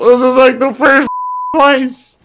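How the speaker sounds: high, loud panicked cries for help; panicking gamer. Real, not acted. Chased and shot in game.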